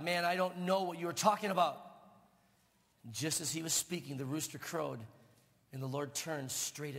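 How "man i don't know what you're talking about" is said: angry denial. Acted.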